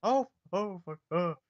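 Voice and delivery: high startled yelp; yelp at the cold. Acted. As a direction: jolt as the cold bites. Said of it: "It's extremely cold".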